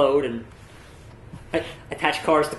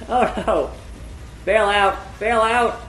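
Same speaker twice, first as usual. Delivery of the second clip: shouting; 6 semitones higher, much slower than usual. Real, not acted. Plane in tornado, bailing out.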